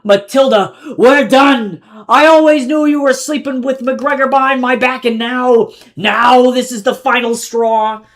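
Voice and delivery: high, loud shouting, slowing at the end; screaming. A narrator's reading. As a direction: scream at the cheating lover. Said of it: "as he screamed at the silent figures".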